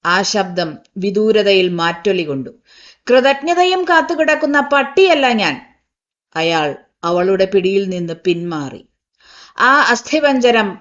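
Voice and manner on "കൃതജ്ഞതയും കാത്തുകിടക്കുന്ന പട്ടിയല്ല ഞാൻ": higher, wounded, proud refusal; bitter retort. Acted. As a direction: fling the words back at her. Uncle rejects gratitude bitterly.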